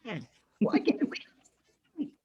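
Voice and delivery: high, slow, drawn-out creature voice; weasely orc voice. Acted. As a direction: play a whiny little weasel of an orc. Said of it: "The fucking Weasley orcs".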